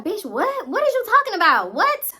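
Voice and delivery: high-pitched mock indignation, climbing to the last word; irritated disbelief. Cousin accused over the empty bottle, irritated disbelief. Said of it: "irritated as hell. She was very irritated".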